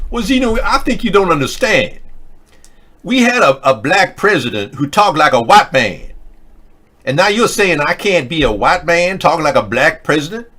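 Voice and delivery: high, loud mock-indignant put-on voice. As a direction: protest in a hammed-up character voice. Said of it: "using that voice".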